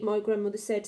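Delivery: ordinary delivery, nothing marked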